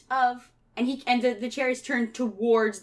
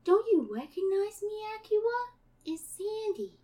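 put-on high child voice slowing into a drawn-out plea; creepy-sweet child, stretching the final words; eerie little girl Sandy; 3 semitones higher, 4 dB quieter than usual. Sandy begging Akira to play forever, slowing eerie child voice.

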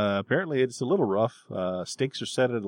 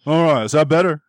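much louder, higher-pitched mock man's voice; masculine fairy voice; 5 semitones higher, 10 dB louder than usual. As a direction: put on a manly voice, loudly. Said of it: "a more masculine voice comes across".

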